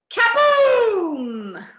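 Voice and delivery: loud, high booming exclamation; explosion sound. A narrator's reading. Narrator announces an explosion with a loud boom. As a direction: burst out like a cannon going off.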